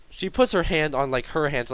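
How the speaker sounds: ordinary delivery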